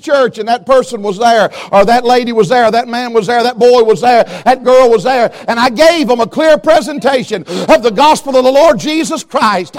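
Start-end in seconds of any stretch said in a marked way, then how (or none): none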